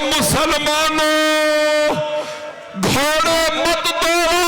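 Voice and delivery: high, slow, crying plea; a little girl begging the riders, wailing; wailing child's cry. A narrator's reading. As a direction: cry out like a small girl in tears. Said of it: "एक छोटी सी बीबी रो रो के कह रही हो".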